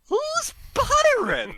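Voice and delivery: very high, slow, utterly bewildered; dazed drunk Ed. Retold or imitated. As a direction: squeak it out like a lost drunk. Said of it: "Ed's like".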